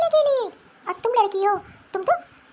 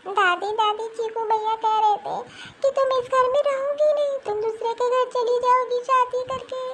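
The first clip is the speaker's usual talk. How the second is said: put-on high child voice tattling to grandma; a little girl hurt and asking to be told it's a lie; complaining little girl; 6 semitones higher than usual.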